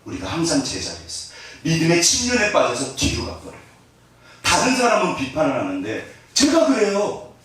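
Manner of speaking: ordinary delivery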